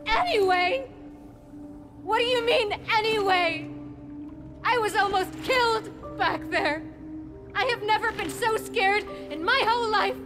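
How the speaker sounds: high, loud, indignant and shaken; hurt, angry outburst. Acted. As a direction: explode at him after a terrible scare. Game scene, upset confrontation.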